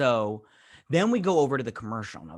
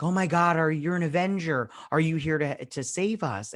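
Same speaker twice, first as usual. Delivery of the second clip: higher, urgent imitation of awe; mock amazement; 5 semitones higher, 3 dB louder than usual. Woman meets an Avenger, imitated excited amazement. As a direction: retell her hopeful astonishment with raised pitch.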